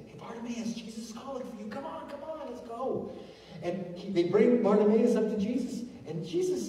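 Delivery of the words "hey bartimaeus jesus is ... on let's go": high, softened imitation of an excited crowd; eager urging. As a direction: urge him along, high and hushed. Crowd urging the blind man.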